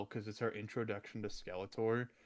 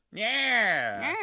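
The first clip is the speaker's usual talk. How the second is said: nasally villain voice; 9 semitones higher, 7 dB louder than usual. Retold or imitated. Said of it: "He sounds nothing like the".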